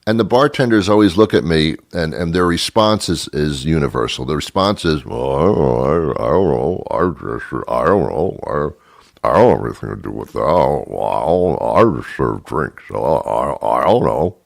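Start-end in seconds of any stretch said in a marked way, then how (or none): 5.0-14.4 low, dopey bartender playing dumb; stupid voice. Bartender to complaining customer, dopey low voice. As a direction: mumble like you know nothing. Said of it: "talking in that stupid voice".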